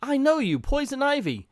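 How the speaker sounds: lightly voiced Harley recognizing Ivy; Harley's voice. Harley voiced reading comic.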